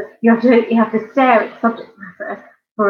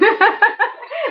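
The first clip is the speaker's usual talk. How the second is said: a squealing giggle, pitched way up; high laugh; 13 semitones higher than usual. Real, not acted. Laughing to the chat at the commenter's name. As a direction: burst out laughing at the silly name.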